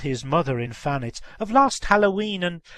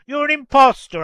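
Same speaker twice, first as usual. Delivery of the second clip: high, loud accusation; angry; 9 semitones higher, 11 dB louder than usual. A narrator's reading. Old gentleman denouncing the girl at his door, angrily. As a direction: burst out indignantly at an impostor. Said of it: "said the old gentleman angrily".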